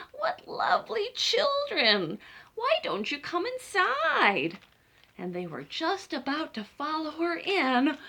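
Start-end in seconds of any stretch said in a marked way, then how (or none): 0.1-4.6 put-on high, slow sugary old witch; sweet witch. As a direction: lure the children in sweetly. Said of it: "the old woman... she said".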